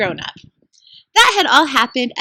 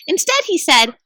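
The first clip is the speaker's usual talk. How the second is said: ordinary delivery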